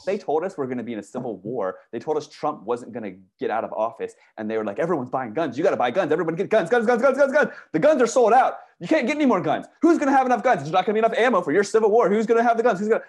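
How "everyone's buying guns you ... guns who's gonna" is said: mock frenzy, much higher and louder, rapid fire; frantic gun hype. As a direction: parody a panicked crowd yelling. Mocking the gun-buying panic.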